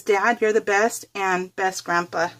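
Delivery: ordinary delivery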